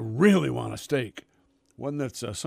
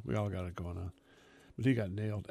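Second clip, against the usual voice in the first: normal talking voice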